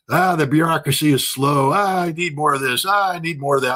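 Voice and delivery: hammed-up whine of elderly complainers; complaining old people. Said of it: "It's normal old people complaints".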